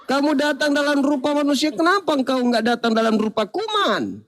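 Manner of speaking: high-pitched protest of an aggrieved germ; hammed-up, high complaining yell; mock shouting germ. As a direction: cry out to God in mock outrage, drawing out the endings. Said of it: "Kuman akan teriak".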